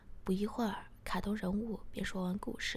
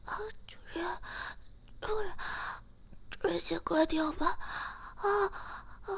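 much higher, halting character voice; small pleading voice; 10 semitones higher than usual. A narrator's reading. Character pleading with the narrator, high halting voice. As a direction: plead in a high, broken-up little voice. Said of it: "并细声地说".

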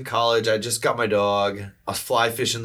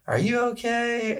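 put-on little girl; 12 semitones higher than usual. Little girl asking through the door if he's okay, high imitated child voice. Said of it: "this little girl".

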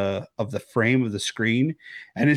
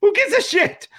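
high, disbelieving yelp at the screen; incredulous outburst; 3 dB louder than usual. Retold or imitated. A viewer yelping at the screen in disbelief over an elbow greet. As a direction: act like you can't believe what you just saw.

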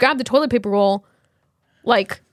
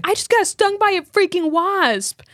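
high, slow, indignant; retold angry complaint; 8 semitones higher, slower than usual. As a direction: spit out the wasp complaint. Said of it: "I'm like".

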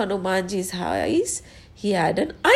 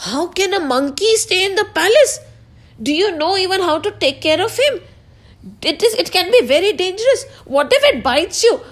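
high, rapid-fire worried mother; alarmed objection; 7 semitones higher, much faster than usual. Acted. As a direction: rattle off your objections in a high voice. Mother objects to keeping the monkey.

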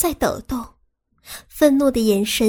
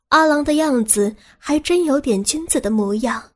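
ordinary delivery, nothing marked

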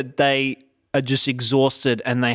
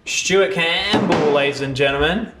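drawn-out, higher-pitched stage introduction; announcer intro; 4 semitones higher than usual. Real, not acted. Host brings Stuart Campbell on to the audience, announcer intro. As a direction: stretch out the name like an MC.

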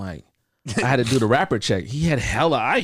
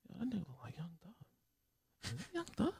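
surprised whisper; 9 semitones higher, 19 dB quieter, faster than usual. Retold or imitated. Recognizing rapper, whispering.

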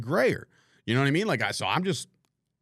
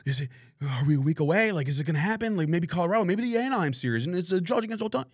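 mock-anxious fans, pitch climbing higher and higher; worked-up fans; 6 semitones higher than usual. Host mimicking people speculating over batting practice, mock-excited. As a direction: imitate fretful fans, faster and higher toward the end.

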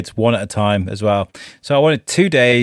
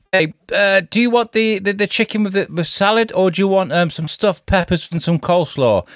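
partner's offer; 9 semitones higher than usual. Retold or imitated. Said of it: "And she's going".

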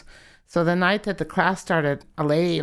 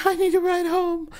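much higher, desperate plea for a ride; 13 semitones higher than usual. Retold or imitated. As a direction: beg for a ride home, near panic. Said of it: "And finally I said".

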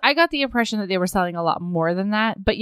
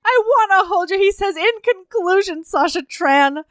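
rising, faster heartfelt speech; bad acting; 5 semitones higher than usual. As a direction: pour out a love declaration. Retells heartfelt proposal speech.

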